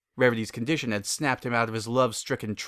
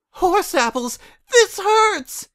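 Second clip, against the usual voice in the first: much higher, loud shrieking pain; Rarity shrieking after hurting her hoof; pained shout; 8 dB louder, much slower than usual. Acted. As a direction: yell it out as the pain hits. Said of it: "Rarity shouted".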